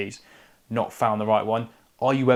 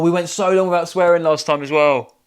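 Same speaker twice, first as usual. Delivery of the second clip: dismayed outburst; 7 semitones higher, 7 dB louder than usual. Real, not acted. Dismayed after letting a swear slip.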